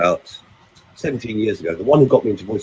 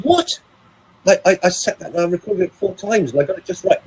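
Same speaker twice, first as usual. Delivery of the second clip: high-pitched, indignant disbelief; mock-wounded protest, voice shooting up; retold shocked protest; 9 semitones higher than usual. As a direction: act out being stunned and defending yourself. Retells his shocked, protesting reply.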